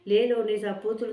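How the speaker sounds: put-on old farmer calling back from far off; calling from afar. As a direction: shout it back from across the field. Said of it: "دور کھولے سے چاچا بولا".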